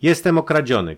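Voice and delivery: loud, high cry of alarm; sudden cry. A narrator's reading. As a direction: cry out at discovering a theft. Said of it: "krzyknął nagle".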